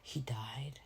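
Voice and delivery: unvoiced whisper; whispered spoiler. Real, not acted. Warning listeners of a movie spoiler, whispered. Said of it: "cover your ears".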